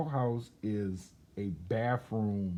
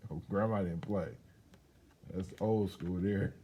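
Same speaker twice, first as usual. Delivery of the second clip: plain reading voice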